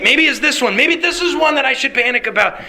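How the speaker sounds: high, anxious second-guessing; mock pre-panic. Worrier hunting for something to panic about, mock pre-panic. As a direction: imitate a worrier, pitch climbing. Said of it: "of pre-panic".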